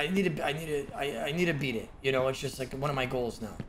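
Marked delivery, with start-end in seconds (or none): none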